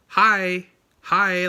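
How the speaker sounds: loud high chirpy Hi like hello; exaggerated American hi. Shown as a demonstration. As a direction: overdo a cheery American hi. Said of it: "You don't say".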